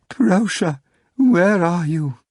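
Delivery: high, drawn-out tender plea; tender, melodic call. A narrator's reading. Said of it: "in a tender, melodic voice, a little nasal from all the crying".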